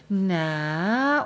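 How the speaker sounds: a lightly voiced seductive "Na"; mock sultry come-on. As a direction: tease a flirty "Na", only half in earnest. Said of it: "Verführung sind nicht die Strapse und dieses".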